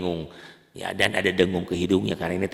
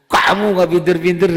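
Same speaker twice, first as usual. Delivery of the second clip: much higher, louder scolding quote; mock the father's scold; imitating an angry father; 11 semitones higher, 10 dB louder than usual. Teacher retelling how his father scolded him, mock scold. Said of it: "katanya gitu ya".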